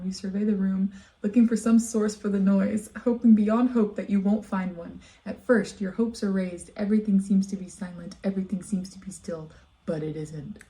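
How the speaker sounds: lightly spooky reading; story narration. Acted. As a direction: read it like a campfire tale. Reads creepypasta aloud.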